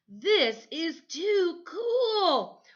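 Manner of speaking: put-on high, slow, thrilled boy voice; drawn-out gush of delight; excited boy. Michael on the train, thrilled by the sleeper car. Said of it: "he said".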